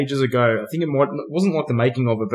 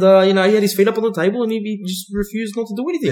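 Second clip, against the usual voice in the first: higher, quick, meek mock whine; high-pitched voice; 8 semitones higher than usual. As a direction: squeak it out fast and innocent. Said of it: "in a real high-pitched voice".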